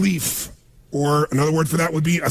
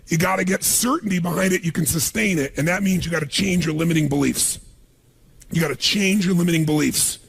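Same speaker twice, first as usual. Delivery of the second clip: ordinary talk, nothing marked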